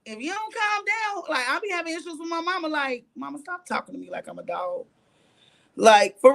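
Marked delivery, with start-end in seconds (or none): 0.1-1.2 mimicked scolding in a much higher voice; high-pitched scolding. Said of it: "very high pitched". 3.1-4.9 lower, softer retold protest; subdued plea. Retold plea to her mother.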